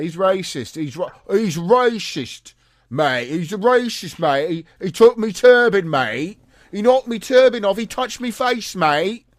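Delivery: loud, high-pitched British bystanders complaining; mock bystanders' clamour. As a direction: yell it as an outraged, mocking British caricature. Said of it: "You hear a bunch of Sikhs talking about".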